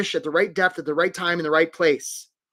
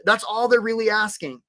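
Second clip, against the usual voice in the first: ordinary delivery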